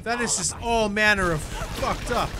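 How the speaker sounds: real outrage at a horror; freaked out. Real, not acted. Reacting to monster.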